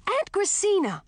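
high, scolding call to an aunt; sharp high exclamation of protest; shocked calling out. A narrator's reading. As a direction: cry out in high-pitched dismay. Niece bursts in on aunt.

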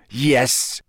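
curt one-word teacher's reply. A narrator's reading. Teacher to interrupting pupil, curt. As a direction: answer curtly, as if interrupted. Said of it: "said Snape curtly".

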